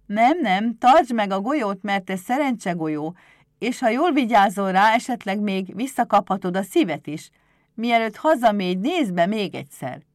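loud voice meant to be overheard; deliberately loud. A narrator's reading. Éder speaks so Pumukli hears, loud and pointed. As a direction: say it loudly for a hidden listener. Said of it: "hangosan, hogy Pumukli is meghallja".

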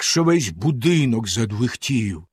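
usual speaking voice

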